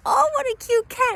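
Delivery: very high cooing over a cute cat. Retold or imitated. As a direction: coo at the cat like it's a baby. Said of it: "cooing, like".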